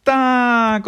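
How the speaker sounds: very high, loud shout; eager child. A narrator's reading. Little fox answering grandfather, high delighted shout. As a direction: squeal a long happy yes.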